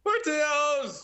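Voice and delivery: Portillo's drawn out high and slow; high, slow Seinfeld-style Portillo's; Jerry Seinfeld. Shown as a demonstration. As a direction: stretch out Portillo's like a sitcom comedian. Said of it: "now do it as Jerry Seinfeld".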